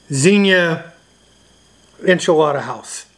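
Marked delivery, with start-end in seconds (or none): none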